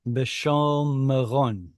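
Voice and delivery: B'shom Meron drawn out slowly with a long o; slow model reading. Shown as a demonstration. Teacher models the correct reading for the student, very slowly. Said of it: "Uh, long vowel".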